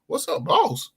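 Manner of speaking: high, breezy "What's up, boss?"; tossed off like a cocky young guy; mock cocky greeting. Imitating daughter's date greeting him, high and breezy. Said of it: "You mean it like boss".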